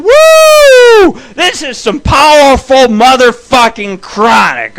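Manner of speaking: high, loud, whooping amazement; stoned excitement. Retold or imitated. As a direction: shout it like you're blown away by your own weed. Stoned amazement after tornado news.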